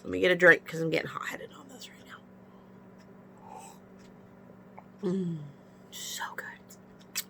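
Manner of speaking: breathy whisper, fast; whispered aside. Real, not acted. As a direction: mutter it under the breath. Aside while taking a drink.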